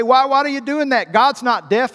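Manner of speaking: loud, high mock objection; retold loud protest. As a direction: imitate a nervous friend protesting loudly. Retold protest of nervous friends.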